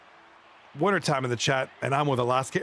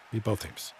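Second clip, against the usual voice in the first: much softer and lower, muttered to himself; quiet repeat; 7 semitones lower, 7 dB quieter than usual. Real, not acted. Streamer repeating his hope for his team, softly muttered. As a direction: say "both" again under your breath.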